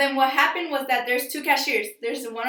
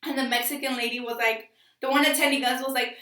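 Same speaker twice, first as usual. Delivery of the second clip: usual speaking voice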